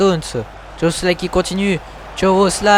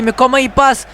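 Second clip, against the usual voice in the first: excited sports commentary; 8 semitones higher, 4 dB louder than usual. Acted. Dribble praised in play-by-play.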